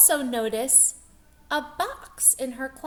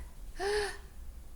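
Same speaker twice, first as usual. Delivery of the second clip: sharp surprised gasp; 9 semitones higher, 8 dB quieter than usual. Acted. Teacher gasping at the map for the class. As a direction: gasp in wonder at the page. Reader shows surprise at picture.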